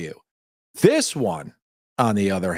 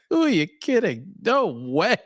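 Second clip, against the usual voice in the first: higher, scoffing brush-off; a mocking no-way; mock scornful rejection; 7 semitones higher than usual. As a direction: sneer it like turning someone down. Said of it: "Okay, so kinda like this".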